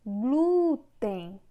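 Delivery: GLÚten with GLÚ stretched long and loud; GLÚ-ten, first syllable swelling as if calling someone; calling out, stressed syllable. Shown as a demonstration. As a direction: call out Glúten, leaning hard on the first syllable. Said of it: "faz como se estivesse chamando".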